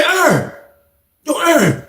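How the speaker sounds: high-pitched call to a neighbour. Retold or imitated. Inmate calling the next cell, high call. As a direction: call his name up high.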